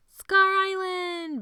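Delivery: very high, slow, loud crow. A narrator's reading. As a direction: crow it out in triumph. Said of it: "Benny crowed".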